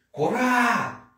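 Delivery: high, drawn-out aggressive cry; challenge to fight. Shown as a demonstration. Man challenging another to a fight, aggressive yell. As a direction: cry out high to confront an opponent. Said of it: "intimar um outro cara pra brigar".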